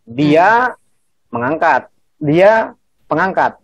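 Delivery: slow demo with DIA set apart by a pause; intonasi jelas. Shown as a demonstration. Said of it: "pakai intonasi juga bisa". (intonasi jelas, jeda setelah DIA)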